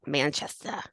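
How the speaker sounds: a hint of Boston accent on Manchester. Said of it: "I wanna go a little bit Boston".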